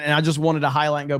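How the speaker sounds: usual speaking voice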